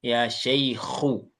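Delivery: Ya shaykhu slowly, throaty kh, single dhamma u; slow model reading. Shown as a demonstration. Teacher corrects the vocative for a student, slow.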